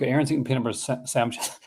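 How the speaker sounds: ordinary delivery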